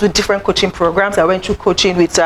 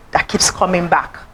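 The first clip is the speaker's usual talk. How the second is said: ordinary talk, nothing marked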